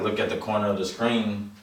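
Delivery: ordinary talk, nothing marked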